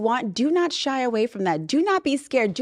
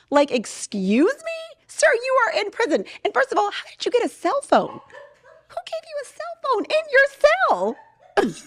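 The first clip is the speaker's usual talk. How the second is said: much higher, disbelieving squeal; incredulous; 14 semitones higher than usual. Real, not acted. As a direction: sound truly baffled. Mocking prison dater incredulously.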